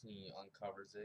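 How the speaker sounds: normal talking voice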